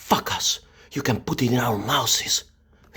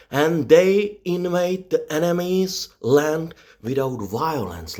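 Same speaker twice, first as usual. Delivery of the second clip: ordinary delivery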